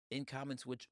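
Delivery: normal talking voice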